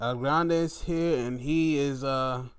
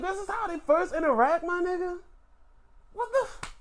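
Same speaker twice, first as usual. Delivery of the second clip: shocked disbelief; 4 dB louder than usual. Real, not acted. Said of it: "reacting in disbelief".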